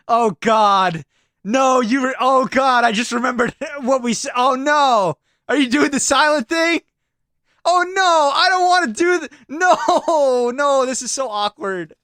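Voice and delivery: high, loud comic panic with laughs breaking in; panicked wailing. Pretend. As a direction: wail in dread of the awkwardness. Panics at the silent bit.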